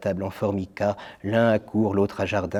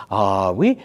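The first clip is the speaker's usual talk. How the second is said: without conviction; 2 semitones lower than usual. Acted. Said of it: "répond le garçon sans conviction".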